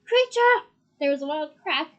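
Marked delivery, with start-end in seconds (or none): 0.0-0.7 called out loud and higher; calling out. A narrator's reading. Harry calling the house elf, loud. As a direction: raise your voice and summon him. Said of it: "he raised his voice and called".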